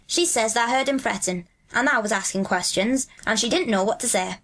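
broad Yorkshire dialect, lilting; Dickon, friendly country lad. Acted. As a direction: chat like a Yorkshire moor boy. Voices Dickon in dialogue.

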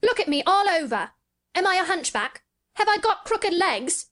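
put-on high, imperious boy voice; high-pitched, commanding demand. A narrator's reading. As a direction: challenge him like a young master. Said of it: "he commanded".

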